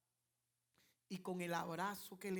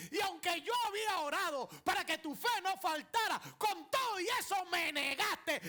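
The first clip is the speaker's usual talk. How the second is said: loud, high shouted sermon; fiery preaching; 7 semitones higher, 9 dB louder than usual. Acted. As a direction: preach it at full force to the crowd. Preaching at full force.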